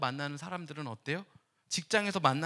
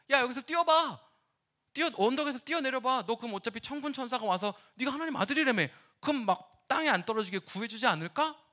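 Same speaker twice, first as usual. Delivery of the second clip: higher, louder taunt, goading; a lightly voiced tempter daring him; taunting Satan; 7 semitones higher, 4 dB louder than usual. Satan tempts Jesus to jump, lightly voiced taunt.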